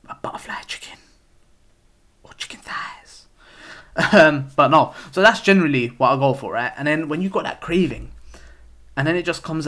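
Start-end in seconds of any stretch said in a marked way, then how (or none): 0.1-3.3 hushed whisper of a tempting order; soft conspiratorial whisper. Real, not acted. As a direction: breathe it out like a secret treat.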